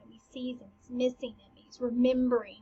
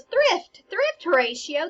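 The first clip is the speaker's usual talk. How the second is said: lightly sassy, pitched-up retort; 6 semitones higher, 6 dB louder, slower than usual. Retold or imitated. Hamlet mocking the hasty wedding to Horatio, mild shade. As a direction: throw it off with a hint of shade. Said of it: "Hamlet goes".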